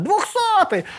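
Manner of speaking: high called-out cry; mock shout. Captors yell that the prisoner is killed, high call. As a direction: call it out, half laughing.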